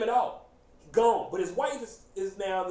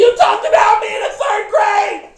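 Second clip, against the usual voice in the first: high, very loud grievance; mock outraged shout; 12 semitones higher, 16 dB louder than usual. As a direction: bellow it like a wounded schoolboy. Mocks a fragile man lashing out.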